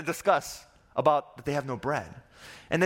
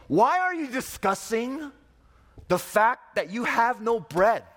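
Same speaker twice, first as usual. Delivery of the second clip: much higher, slow and theatrical rebuke; overly dramatic; 7 semitones higher, slower than usual. Acted. Jesus rebukes his disciples about bread, overly dramatic and slow. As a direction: ham up an exasperated rebuke, slowly. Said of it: "Excuse me for being overly dramatic".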